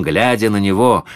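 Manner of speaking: ordinary delivery, nothing marked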